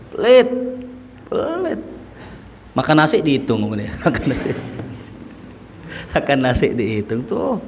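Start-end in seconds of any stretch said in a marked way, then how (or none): 0.1-1.8 high mocking cry of stingy; stingy verdict. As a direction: fling out the word like a complaining wife. Wife's verdict, high pitched. (istri mengeluh dengan nada tinggi: pelit)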